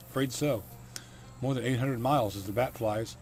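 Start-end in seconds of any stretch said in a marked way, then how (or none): none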